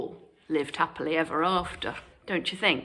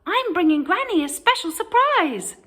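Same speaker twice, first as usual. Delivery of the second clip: put-on excited little girl; 12 semitones higher, 5 dB louder than usual. A narrator's reading. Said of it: "Little Red skipping happily".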